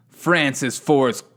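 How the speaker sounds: ordinary delivery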